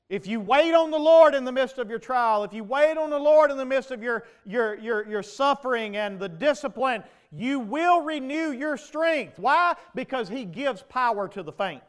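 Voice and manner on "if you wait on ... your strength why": loud, higher preaching at full force; fiery preaching. Acted. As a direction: drive the promise home to the congregation.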